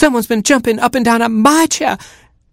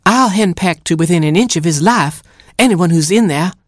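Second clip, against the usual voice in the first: higher, fierce scolding mother hen; 10 semitones higher than usual. Acted. Mother hen scolding any intruder, higher fierce voice. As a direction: threaten like an angry hen. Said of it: "scolded Mama Hen".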